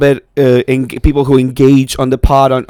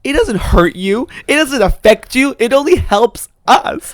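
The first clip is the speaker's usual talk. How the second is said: high, measured, insistent scolding; on the attack; 7 semitones higher than usual. Real, not acted. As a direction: lecture them slowly, pitched up. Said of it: "attack in the way that she is".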